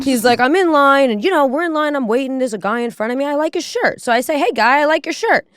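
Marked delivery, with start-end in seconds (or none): 0.4-4.0 lightly voiced guy, pitched up; high-pitched mock anecdote voice; imitated storyteller. Voices the man telling his story. 4.4-5.5 high-pitched mock guy hailing a stranger; lightly put-on voice, pitched up; imitated friendly compliment.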